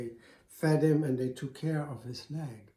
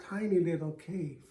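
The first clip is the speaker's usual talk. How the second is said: ordinary delivery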